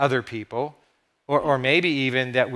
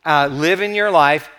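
usual speaking voice

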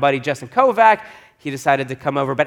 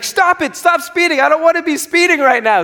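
high, louder pleading order; insistent demand; 13 semitones higher, 4 dB louder than usual. Retold or imitated. Wife ordering husband to stop speeding, high and urgent. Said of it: "she's like".

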